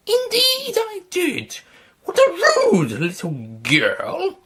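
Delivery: put-on high, slow, haughty old woman; a proud old lady, drawn out and indignant.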